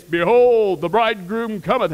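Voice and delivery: booming herald's proclamation; big, ringing acted call; warning cry. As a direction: announce it loudly like a town crier. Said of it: "The only, the only warning you're gonna get".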